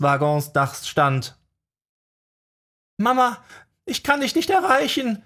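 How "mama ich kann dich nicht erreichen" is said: whining. A narrator's reading. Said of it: "jammerte er".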